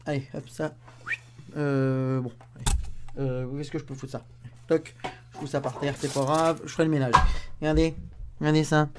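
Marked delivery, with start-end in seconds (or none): none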